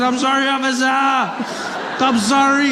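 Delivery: high, wailing apology; panicked pleading. Acted. Driver lying on the road begging the officer, panicked. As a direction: beg high and drag out the last word. Driver begs a cop not to shoot.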